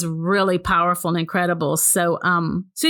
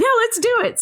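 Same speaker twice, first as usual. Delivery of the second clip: very high, laughing mock enthusiasm; eager agreement. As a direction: imitate an eager yes through laughter. Husband agrees again, teller laughing.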